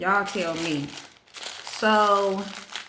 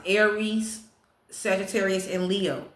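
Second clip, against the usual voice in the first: ordinary talk, nothing marked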